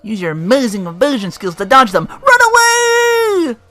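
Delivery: high, loud warning building to a long yell; hammed-up warning. Telling the player to dodge magic beams, mock-panicked yell. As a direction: raise it into a drawn-out, very high mock shout.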